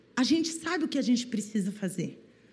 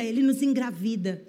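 usual speaking voice

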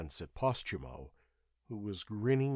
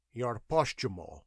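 sullen. A narrator's reading. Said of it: "answered the charioteer sullenly".

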